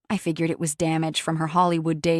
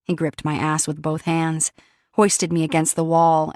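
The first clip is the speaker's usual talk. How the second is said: ordinary delivery, nothing marked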